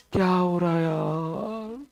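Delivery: low, soft groaned-out moan; mock weary complaint.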